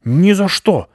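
high, loud outburst; indignant dog flaring up; angry refusal. A narrator's reading. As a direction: refuse explosively. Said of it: "рассердился пёс".

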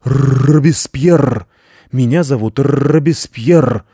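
slow, loud growl with rolled R; offended guard dog correction; angry dog. A narrator's reading. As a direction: snarl your proper name indignantly. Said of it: "сердито, но вполголоса".

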